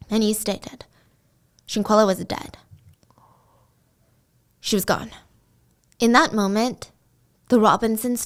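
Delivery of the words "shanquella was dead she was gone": understated, emotionless retold announcement; devoid of emotion. Friend calling parents to break the news, imitated emotionless delivery. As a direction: report it blankly, with a long pause between sentences. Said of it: "devoid of any emotion".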